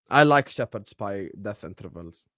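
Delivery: normal talking voice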